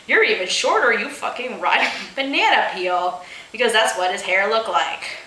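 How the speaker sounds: normal talking voice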